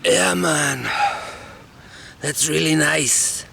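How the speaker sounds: slow, strained stoner voice; stoned smoker. Acted. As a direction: talk as if holding in a lungful of smoke. Stoned sauna guys smoking a spliff.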